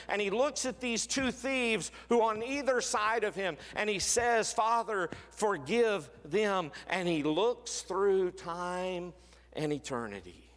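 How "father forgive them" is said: a high, slow, fervent plea from the cross; impassioned cry. Acted. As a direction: cry out Father, forgive them at the peak. Climax of repeated cross words.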